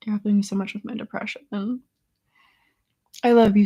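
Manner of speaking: usual speaking voice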